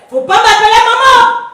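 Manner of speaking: mother refusing the name, enraged; angry outburst. Acted. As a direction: lash out against the word maman. Mother rejects being called maman.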